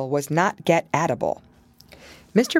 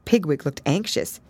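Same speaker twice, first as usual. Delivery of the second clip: ordinary delivery, nothing marked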